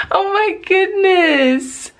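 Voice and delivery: high, very drawn-out exclamation; overjoyed. Real, not acted. Mother marveling at baby's first word, overjoyed. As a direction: stretch it out in real amazement.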